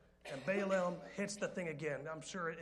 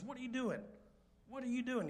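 higher exasperated scolding; frustrated Balaam; 7 semitones higher than usual. Retold or imitated. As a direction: snap at the donkey in disbelief. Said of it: "hits the donkey and says".

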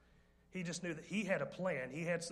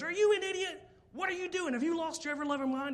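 much higher, louder outraged shriek; shrieking wife; 12 semitones higher, 8 dB louder than usual. Retold or imitated. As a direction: scream at him like a furious wife. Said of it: "And his wife goes".

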